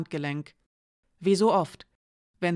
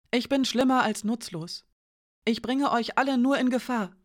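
put-on higher, disbelieving young woman; Janka's voice; 5 semitones higher than usual. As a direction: voice Janka higher, shaken and accusing. Janka blames herself.

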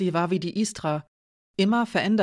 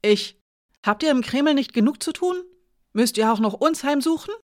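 higher, louder, fearful but defiant; a child afraid yet standing her ground; high frightened voice; 7 semitones higher, 5 dB louder than usual. A narrator's reading. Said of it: "Jankas hohe Stimme verriet ihr Unbehagen, ihre Angst". (hohe, ängstliche Stimme)

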